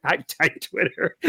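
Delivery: giggling through a soft hello; laughing hello. Real, not acted. Host laughing as he greets Twitter, giggly.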